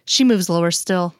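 usual speaking voice

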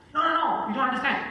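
high, loud protesting no; frustrated protest. Retold or imitated. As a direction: plead loudly like an upset caller. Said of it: "And you go".

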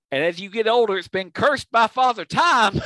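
imitated sitcom yell, building to the end; yelled comeback. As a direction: yell it back as a sitcom punchline. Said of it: "Sanford yells back".

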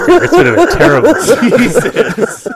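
high, loud laughing; cracking up mid-sentence. Real, not acted. Host cracking up at guest.

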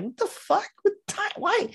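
high squeaky disbelief, way up in pitch; baffled outburst. Retold or imitated. Viewer reacting to clumsy exposition, high baffled squeak. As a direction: shriek out the confusion like a lost viewer. Mocking own confused reaction.

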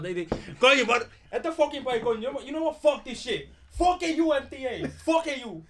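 high, loud outraged rant; shouting in disbelief at the fare; furious yelling. Acted. Outraged rider in skit.

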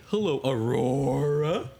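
slow drawn-out lion greeting. Acted. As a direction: greet her slowly as the lion. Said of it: "I'll bring the lion out and I'll say".

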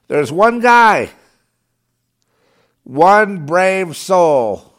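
loud, high and very slow, each word hammered; emphatic shout. Real, not acted. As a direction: drag it out in loud indignant admiration. Ranting, praising lone heckler.

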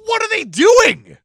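loud, high, drawn-out cry of disbelief; exasperated yell. Pretend. Host baffled by the empty movie set, exasperated yell.

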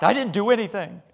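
high-pitched, startled imitation; retold indignant denial, voice jumping up; shocked protest. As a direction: act the woman taken aback. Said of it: "she just looks at him shocked".

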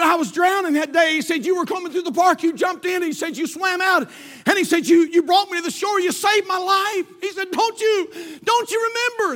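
high, pressing plea; pleading young man. Retold or imitated. Young man pleading with the judge who saved him, high. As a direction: remind him he saved you, urgently.

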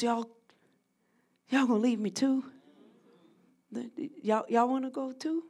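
hurt question to his friends; quiet, hurt Jesus. Retold or imitated. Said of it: "he looks at those he loves".

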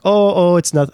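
put-on Doctor James Tan's accent. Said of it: "I'm not gonna do his accent".

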